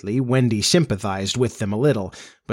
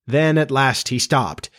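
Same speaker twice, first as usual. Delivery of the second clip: plain reading voice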